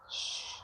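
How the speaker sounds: breathy secret shh; shushing. Real, not acted. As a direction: hush the viewers playfully. Keeping compliment their secret.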